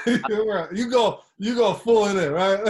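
words said through a laugh. Real, not acted. Teasing his friend about going all in, laughing.

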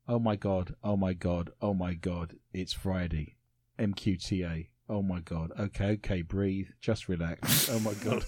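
deadpan, as if reading a book mid-honeymoon; bored honeymoon reading. Shown as a demonstration. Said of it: "going for the bored kind of reading over your shoulder".

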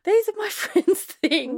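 high, slow mock wail; drawn-out high outcry with laughter in it; freaking out. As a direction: imitate a friend's frantic protest. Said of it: "my friend's freaking out going".

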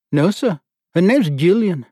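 put-on lieutenant answers the board in Australian accent. A narrator's reading. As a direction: answer crisply with Australian vowels. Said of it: "Jiff's accent had a startling effect".

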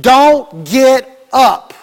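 very slow, one word at a time; retold firm order. Nurse warning the injured woman, retold slow firm order. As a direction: space out each word for emphasis. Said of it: "The nurse told her".

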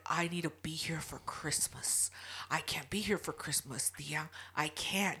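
low, hushed near-whisper pleading; panicked nephew imitation. Nephew phoning auntie about the dog, hushed imitated panic. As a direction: mimic a helpless plea, very softly. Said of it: "He calls me in a fucking panic".

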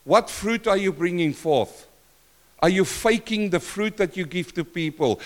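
normal talking voice

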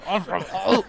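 loud, high caricature of Hull; mock Hull accent. Shown as a demonstration. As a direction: Hull accent as if an egg is stuck in the throat. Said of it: "Everyone's got a hard-boiled egg stuck in their throat".

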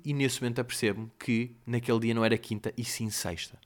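normal talking voice

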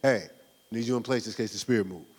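a hint of Ty giving a low cue; quiet quoted instruction. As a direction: murmur it like a quick cue. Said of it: "He said".

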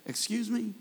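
a soft, sassy "Excuse me?"; hint of an offended widow, held back; mock attitude. Grieving widow answering Jesus, mock sassy and soft. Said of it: "if this woman had a little attitude".

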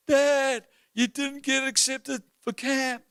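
high-pitched mock-crying daughter; tearful child imitation. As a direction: wail it slowly like an upset kid, acted. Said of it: "burst into tears crying and goes".

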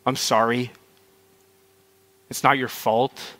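quiet, sincere compassion; tender reassurance. Real, not acted. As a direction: console softly, leaving room between phrases. Consoling abuse survivors in the room.

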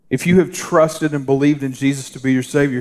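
ordinary talk

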